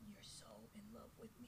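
fast giggling whisper; whispered laughing. Real, not acted. Line through laughter.

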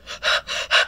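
acted-out gasp of shock; sharp unvoiced intake of breath. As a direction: gasp as if hearing something appalling. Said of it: "and I went".

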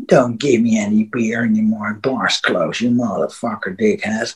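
put-on low drunken mutter under the breath; muttering to himself. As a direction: grumble it quietly to yourself, sulking. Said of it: "talked to himself".

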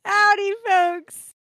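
high slow drawled howdy; cowboy host voice. Pretend. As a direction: greet folks like a country radio host. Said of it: "I'm your host, Conway Titty".

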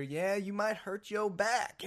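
high, drawn-out teasing woman's voice; imitated store worker. Worker teasing a customer about lifting her, imitated voice. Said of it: "I am quoting verbatim how and what was said".

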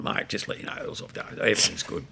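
hushed whispered aside. Retold or imitated. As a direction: whisper it as a private word pulled aside. Danny quietly pulls him aside.

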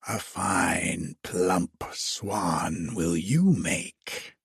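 low, slow, hissed threat; hissing witch. A narrator's reading. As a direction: hiss it like a gloating witch. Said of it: "hissed the old witch".

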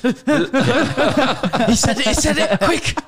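high-pitched words squeezed out through laughter; barely getting the words out for laughing; laughing hard. Real, not acted. Teasing a friend about a market lad, laughing hard.

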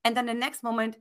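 ordinary talk, nothing marked

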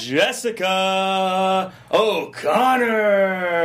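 slow, stretched-out announcer call; wrestling commentator voice. Shown as a demonstration. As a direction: drag the name out for the crowd. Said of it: "your wrestling commentator voice for these intros".

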